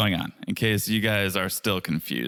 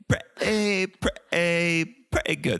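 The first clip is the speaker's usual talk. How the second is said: put-on drawn-out, higher British-accented catchphrase; British Larry David; 6 semitones higher, much slower than usual. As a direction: stretch each word in put-on British. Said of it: "it's a British Larry David for ya".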